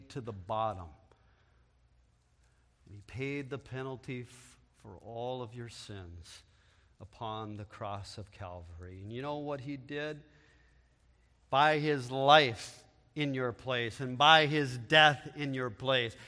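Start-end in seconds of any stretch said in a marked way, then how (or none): none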